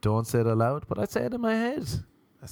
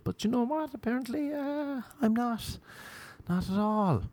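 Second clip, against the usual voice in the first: squeaky embarrassed admission; 13 semitones higher, 5 dB quieter than usual. Real, not acted. Admitting he can't dance.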